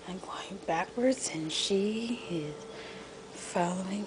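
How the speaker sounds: whispered play-by-play. Real, not acted. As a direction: whisper so she keeps following. Whispered commentary.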